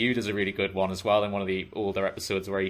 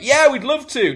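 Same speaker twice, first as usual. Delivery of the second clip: eager crowd; 10 dB louder than usual. Retold or imitated.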